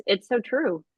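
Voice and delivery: usual speaking voice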